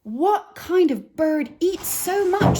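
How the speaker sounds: very high, loud, slow amazement; astonished Missus Bird. Acted. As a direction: marvel at the appetite. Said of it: "said Missus Bird".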